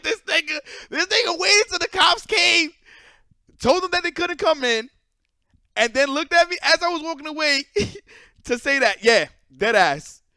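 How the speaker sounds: telling the audience about the friend, furious and laughing; fired up. Real, not acted. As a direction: rant it with disbelieving anger and laughs. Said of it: "And I was tight".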